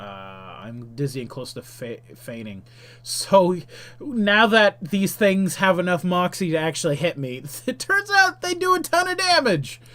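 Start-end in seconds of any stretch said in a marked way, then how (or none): 0.0-2.6 low, soft, trailing mumble; quiet offhand read-through; subdued repeat. Real, not acted. Skims the repeated attack text. 7.7-9.8 very high-pitched squeaky realization; high mock-distressed voice; amused dismay. Real, not acted. Amused dismay at heavy damage.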